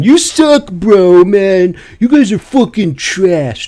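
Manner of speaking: high mocking whiny gamer voice; toxic gamer taunt. Gamer imitating a toxic player, high mocking voice. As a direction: mimic a toxic teammate trashing you. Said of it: "we're hearing".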